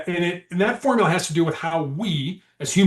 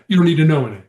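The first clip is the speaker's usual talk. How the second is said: hostile suspect; 8 dB louder, much faster than usual. Acted. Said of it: "negotiation roleplay, suspect".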